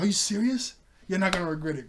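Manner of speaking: acted-out eager delight; thrilled disbelief. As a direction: burst out overjoyed. Acts Beast Boy's delighted reply.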